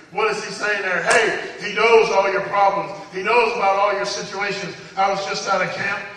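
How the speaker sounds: loud, urgent preacher's encouragement; full-force preaching. Real, not acted. Preacher urging struggling listeners to hang in there, full-force preaching. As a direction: push each point out hard to rally the room.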